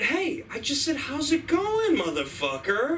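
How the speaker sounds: high, stretched-out mock outrage; slow, hammed-up irritation; indignant stranger. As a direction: repeat the greeting as an offended demand. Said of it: "he looked at me and said".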